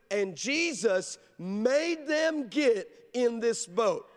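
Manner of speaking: very slow, leaning on "made them"; drawn-out scripture read with "made them" stressed; slow emphatic read. Shown as a demonstration. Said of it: "notice the words made them".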